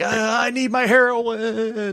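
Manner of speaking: high, slow mock addict's wail; desperate junkie. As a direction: whine it out long and high, hammed-up. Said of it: "he looks like".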